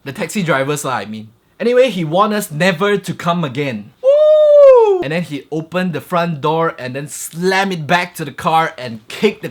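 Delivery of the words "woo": shrill whooping woo, much higher and louder. Real, not acted. Vlogger whooping at the drama, loud high. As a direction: let out a wild whoop. Excited exclamation.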